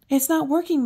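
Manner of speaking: moaning. Acted. Postmaster elf fretting by the window, loud moaning complaint. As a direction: whine it like a worried elf. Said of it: "moaned the mo-, postmaster elf".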